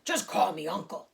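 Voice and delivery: lightly voiced hearty uncle; big hearty voice. A narrator's reading. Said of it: "he said in a big, hearty voice".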